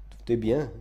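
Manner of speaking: soft, restrained declamation; subdued quotation. A narrator's reading. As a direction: quote it back quietly, letting it fall. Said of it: "dites-vous".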